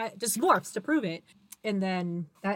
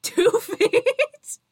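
high, loud, incredulous laugh-question; laughing disbelief; 11 semitones higher, 6 dB louder than usual. Real, not acted. Disbelief at the cat height guess.

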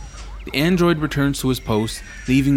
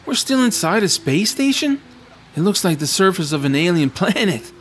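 higher-pitched, wide-eyed astonishment; amazed disbelief; 5 semitones higher than usual. Acted. Traveler finding a whole sky inside a space station, amazed. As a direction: marvel at an impossible sight.

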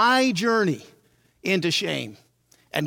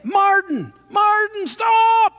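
high, loud frantic call; mom shouting; 13 semitones higher, 6 dB louder, much slower than usual. Retold or imitated. Mom yelling at dad from the porch to stop. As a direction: yell to stop the car.

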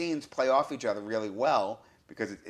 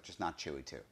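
low, soft retold plea; hushed dread; 6 semitones lower, 12 dB quieter than usual. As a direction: drop it to a small, quiet mutter, imitating a worried viewer. Retelling his dread while watching.

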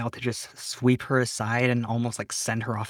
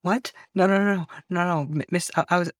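pitched-up flustered no no no, backing off; panicked denial; 6 semitones higher than usual. Acted. Dragon pleads with the girl, panicked and pitched up. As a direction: scramble to deny it, voice jumping up.

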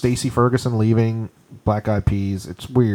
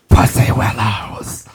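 creepy whisper; 3 semitones lower, much slower than usual. Pretend. Whispered slow creepy phrase.

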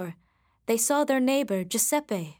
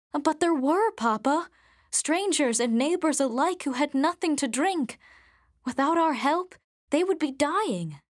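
higher-pitched, earnest protest; insistent daughter; 5 semitones higher than usual. Acted. Daughter defending the thirsty neighbors to her father, earnest protest. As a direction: plead urgently for those in need. Catherine argues back to father.

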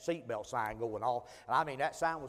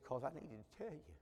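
hushed and much softer; confiding quietly; 13 dB quieter than usual. Retold or imitated. Stranger confessing his past to the preacher, quiet and confiding. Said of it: "his tone began to change".

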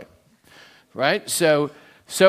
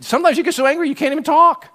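heated preaching; 11 semitones higher, 5 dB louder, faster than usual. Acted. Preaching about uncontrollable anger.

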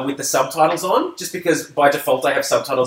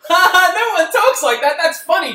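put-on much higher, giggling dismissal of a silly accent; amused laugh; 13 semitones higher, 4 dB louder than usual. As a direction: laugh it off like a kid finding it goofy. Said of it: "It's just, it went from".

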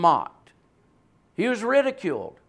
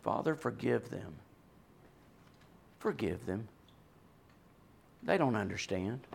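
much softer and lower, almost hushed; quiet forgiving plea; 10 semitones lower, 14 dB quieter than usual. Retold or imitated. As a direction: breathe the words of mercy gently. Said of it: "Not Jesus".

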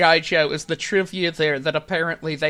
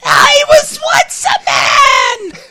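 much higher, slow shrieking wail; Cobra Commander voice; 5 dB louder, slower than usual. Retold or imitated. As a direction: wail it like a cartoon villain. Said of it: "This is an actual, actual line".

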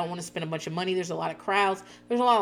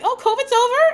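put-on much higher and louder, childlike; mock kids asking; 9 dB louder than usual. Kids asking their mom if covid is over, high and loud. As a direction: squeak it like an excited kid. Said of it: "they were like".

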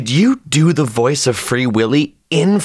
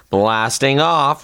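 slow rocket launch; much slower than usual. Shown as a demonstration. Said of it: "spaceship sound effect".

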